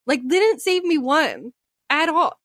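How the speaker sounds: ordinary talk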